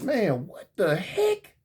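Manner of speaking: a quiet slow what the heck; slow bewilderment. Retold or imitated. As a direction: drag it out, stunned. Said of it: "I'm like".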